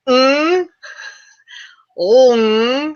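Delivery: high zombie gagging groans; gag. Retold or imitated. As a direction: retch and moan like a zombie. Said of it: "zombie noises, and they go".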